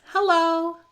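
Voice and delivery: high loud horn-like hello; a tow truck honking hello. A narrator's reading. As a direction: sing it like a friendly honk. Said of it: "Joe moves on and honks".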